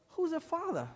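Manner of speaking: pitched well up, short and asking; curious brother. Retold or imitated. Brother asks the preacher who the girl's father is, voiced high. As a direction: play the puzzled little brother.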